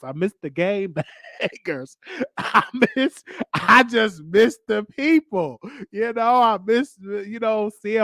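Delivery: playful over-the-top longing; pleading. Retold or imitated. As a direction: plead with mom in a rising, exaggerated voice. Acted plea to his mom.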